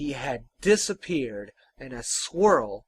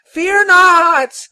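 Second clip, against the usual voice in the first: loud, high, drawn-out cry; anguished high-pitched call; desperate plea; 18 dB louder than usual. Acted. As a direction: plead desperately at the top of a tiny voice. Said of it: "it pleaded, desperate".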